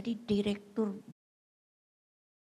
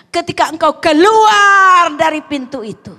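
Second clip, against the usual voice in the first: booming, higher-pitched challenge; emphatic preaching shout; 7 semitones higher, 19 dB louder than usual. Real, not acted. Preacher challenging the congregation about leaving church, loud emphatic shout. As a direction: cry out the challenge, much louder.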